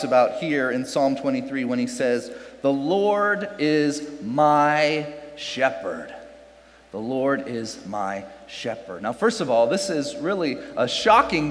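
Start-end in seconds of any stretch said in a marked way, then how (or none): 2.6-6.1 slow, weighty scripture reading; slow solemn quote. Shown as a demonstration. Reading the psalm verse slowly. 6.9-9.0 quieter, lower repeat of the verse; hushed echo of the verse; soft repeat. Shown as a demonstration. Repeats verse softer for effect.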